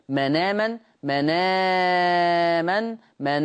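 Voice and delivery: مناما recited stretched, very slow; Warsh transfer reading. Shown as a demonstration. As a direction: chant مناما with the hamza moved onto the nun. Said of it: "حكم النقل".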